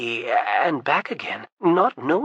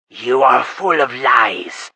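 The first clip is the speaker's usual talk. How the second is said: slow, loud furious accusation; yelled; 2 semitones lower, 7 dB louder, slower than usual. Acted. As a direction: roar out the charge of lying. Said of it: "Kappa yelled".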